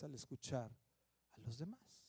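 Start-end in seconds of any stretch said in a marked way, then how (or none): none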